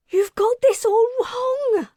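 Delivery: high, loud tearful protest; weeping plea. Acted. Wilder weeping as she pleads with the leader. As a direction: plead through tears. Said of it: "Wilder wept".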